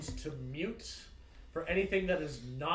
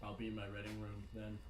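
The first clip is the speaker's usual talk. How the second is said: quiet; 8 semitones lower, 12 dB quieter, faster than usual. Acted. Captain exits, dry remark.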